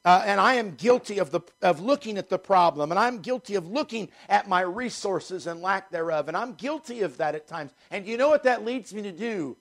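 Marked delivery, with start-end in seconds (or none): none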